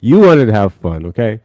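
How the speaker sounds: loud, half-joking telling-off; mock scolding. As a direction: yell it at her playfully. Mock scolding the big sister.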